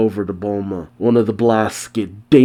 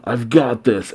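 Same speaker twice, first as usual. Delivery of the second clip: usual speaking voice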